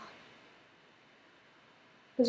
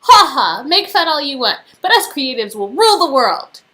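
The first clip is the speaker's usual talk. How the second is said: ordinary talk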